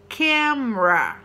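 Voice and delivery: camera said as KEM-ruh, slow and drawn out; slow model pronunciation. Shown as a demonstration. Teacher slowly models the word for Brazilian students, camera as KEM-ruh. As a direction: camera in two syllables, lips closed for the M. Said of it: "Então, camera".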